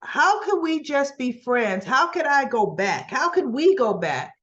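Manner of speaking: acted-out heartbroken questioning; retold anguished protest. Recapper voicing Cam still in love with Joss, wounded.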